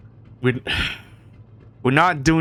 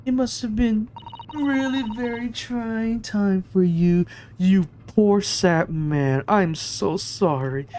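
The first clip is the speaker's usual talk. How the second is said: sympathetic companion; 7 semitones higher, slower than usual. Acted. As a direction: console with hushed, drawn-out pity. Voices companion consoling the stowaway.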